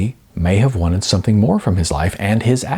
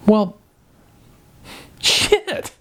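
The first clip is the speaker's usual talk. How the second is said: squeaky dismayed curse; 10 semitones higher than usual. A narrator's reading.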